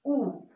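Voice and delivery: U said as a pure "oo"; Spanish U sound. Shown as a demonstration. Teacher introduces the letter U, pure "oo" sound. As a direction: sound U alone as "oo", not "you". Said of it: "It's the U sound".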